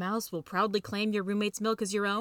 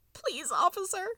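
high, tearful mouse plea; acted tearful begging, high-pitched; crying; 11 semitones higher, slower than usual. A narrator's reading. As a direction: beg in a squeaky, crying voice. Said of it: "the mouse will be crying".

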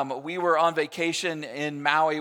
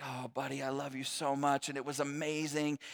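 soft, hushed and lower, full of love; tender father, much softer and lower; 5 semitones lower, 11 dB quieter than usual. Retold or imitated. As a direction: murmur it to the baby on your lap. Father to snuggling baby son.

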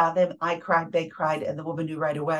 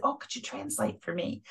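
a soft breathy ask, almost no voice; retold whisper; 8 semitones higher, 6 dB quieter than usual. Hushed request to the bilingual volunteer, whispered. Asking the bilingual helper quietly.

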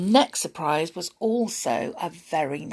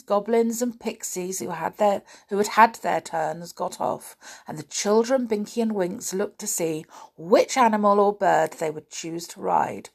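usual speaking voice

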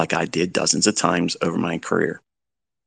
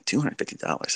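scoffing whispered disbelief; mock whisper; 4 semitones higher, 8 dB quieter than usual. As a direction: whisper it like a doubtful viewer.

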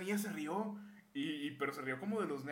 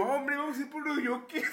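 mock kid invite; 11 semitones higher, 8 dB louder than usual. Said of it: "El tercero de primaria".

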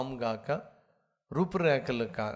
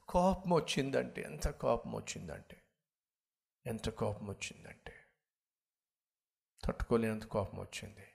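each repeat softer, dropping to a low hush; fading repetition; 3 semitones lower, 7 dB quieter than usual. Retold or imitated. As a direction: let the anger sink into quiet. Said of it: "repeated anger phrase fading". (క్రమంగా తగ్గే మెల్లని స్వరం)

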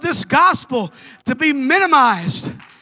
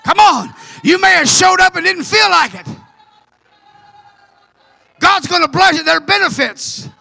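fervent preaching; 3 semitones higher than usual. Acted. Preacher rallying the congregation to move forward, fervent. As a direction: hammer each "now I'm gonna".

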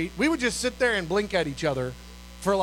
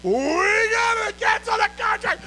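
long, high celebratory yell; excited shout; 12 semitones higher, 3 dB louder, slower than usual. Retold or imitated. Announcing to colleagues in the car, excited shout. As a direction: holler it slowly, stretched and high. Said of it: "everybody's shooting, all excited".

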